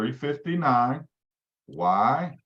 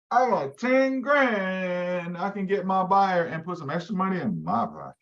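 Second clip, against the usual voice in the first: greedy inner voice; 5 semitones higher than usual. Retold or imitated. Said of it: "A bell goes off in your head saying".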